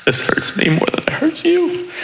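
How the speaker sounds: high, strained pleading parent; mock-tearful squeak while disciplining; hammed-up anguished parent voice; crying parent act. Parent's line acted with a belt.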